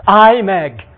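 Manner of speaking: crippled man shouting to Jesus; pleading call. Acted. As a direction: call out desperately. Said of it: "sírt a sok nyomorék".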